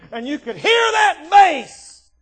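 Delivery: high, slow, loud holler; loud drawn-out pulpit emphasis; emphatic preaching. Real, not acted. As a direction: shout it out, stretching the words. Preacher shouts over imitated band.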